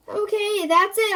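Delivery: high, loud fed-up yell. Acted. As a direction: yell it, at the end of your rope. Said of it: "I yell".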